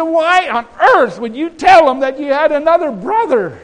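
high, loud, exasperated demand; acted scolding. Jacob asking sons why they told, acted scolding. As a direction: play the father berating his sons.

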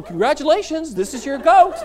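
high, loud prize announcement; gleeful congratulations. Acted. Firemen hand over the goat prize, gleeful announcement. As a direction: present it like a grand prize.

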